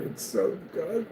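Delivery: soft, slow, tearful put-on; mock weepy. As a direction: whimper it out in mock joy. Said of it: "you just start crying".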